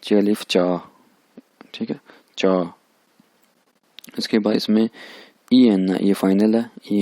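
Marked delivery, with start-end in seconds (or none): 0.0-2.7 teacher models चा; Chinese syllable demo. Shown as a demonstration. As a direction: drill चा clearly for the class. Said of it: "Z H को पढ़ते हैं".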